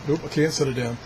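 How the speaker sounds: ordinary delivery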